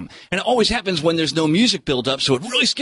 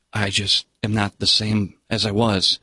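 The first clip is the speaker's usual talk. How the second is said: lightly voiced father, lower pitch; troubled father; 7 semitones lower than usual. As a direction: drop a little into the father's low voice. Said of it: "as you're watching the father".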